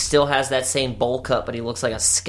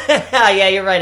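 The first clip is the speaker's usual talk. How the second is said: laughing; 7 semitones higher, 5 dB louder, slower than usual. Real, not acted. Laughing at friend's joke.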